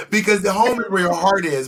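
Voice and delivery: normal talking voice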